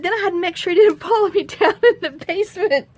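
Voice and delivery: high, flustered protest with a laugh; retold excited self-defense; worked-up excuse. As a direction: plead the case in a high, defensive voice. Excuses to Ryan.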